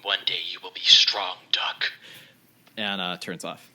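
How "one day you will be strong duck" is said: low, slow device voice sinking to a whisper; Pip-Boy voice. Acted. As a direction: speak as an ominous gadget. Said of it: "Your Pip-Boy turns red and says".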